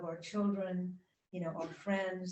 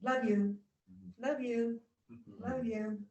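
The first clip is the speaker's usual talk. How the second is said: mock casual "love you"; 4 semitones higher than usual. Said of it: "most popular word in our culture".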